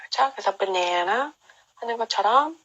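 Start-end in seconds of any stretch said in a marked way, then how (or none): 0.6-1.3 banana slowly, second syllable stressed. Shown as a demonstration. Teacher models banana second-syllable stress. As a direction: lean on the middle na. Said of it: "두 번째 음절에 강세가 있어요".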